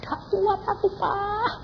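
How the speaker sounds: high, strained voice of suffering; acted high-pitched wail of complaint; mother in agony. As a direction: squeeze it out high, as if in pain. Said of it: "dia bilang".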